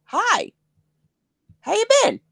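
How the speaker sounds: high, squeaky hello; retold greeting. Wrestler greeting a familiar face, high-pitched.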